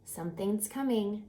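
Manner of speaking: ordinary delivery, nothing marked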